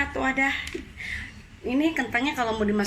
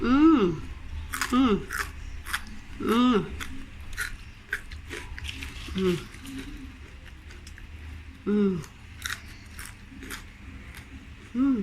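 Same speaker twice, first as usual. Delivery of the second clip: crunching chips; 2 semitones higher, much slower than usual. Real, not acted. Tasting fried potatoes on camera.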